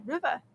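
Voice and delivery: "River" said as "rivuh"; dropped R. Real, not acted. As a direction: say "River" with an open final vowel, no R. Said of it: "you're not saying your R's".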